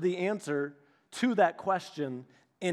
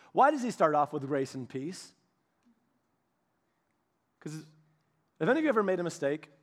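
usual speaking voice

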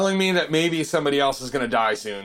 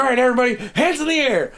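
stage command; 9 semitones higher, faster than usual. Retold or imitated. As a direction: bark it like a frontman firing up the crowd. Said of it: "he's like".

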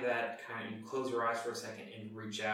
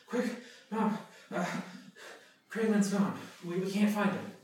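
higher, louder cry for help; raised, alarmed voice reporting trouble; urgent call; 9 semitones higher, 3 dB louder than usual. Acted. Panicked child fetching mom for help.

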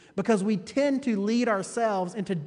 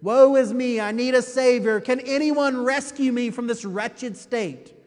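acted despair; 4 semitones higher, 6 dB louder than usual. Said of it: "valley of despair".

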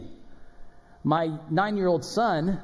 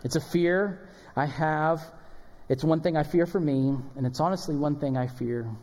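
ordinary talk, nothing marked

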